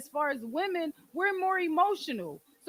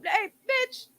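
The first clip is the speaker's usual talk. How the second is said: higher-pitched mock accusation; imitated incredulous questioning, pitched up; suspicious partner; 6 semitones higher, 8 dB louder than usual. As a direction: act out a partner's startled suspicion. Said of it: "look at me funny and be like".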